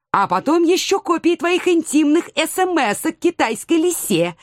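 high-pitched taunting threat; teasing. A narrator's reading. Woman bluffing the cat with his intimate messages, teasing. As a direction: dangle the threat teasingly, voice lifted. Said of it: "Я дразня помахала перед ним своим сотовым".

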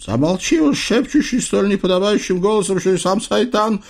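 high-pitched exasperated outburst; snapping. Acted. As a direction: snap at him, losing patience. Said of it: "сорвался Насреддин".

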